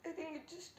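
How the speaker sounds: ordinary talk, nothing marked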